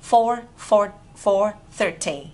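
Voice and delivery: deliberate, separated number words; slow model. Shown as a demonstration. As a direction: pause between "four" and "thirty". Teacher models the time slowly.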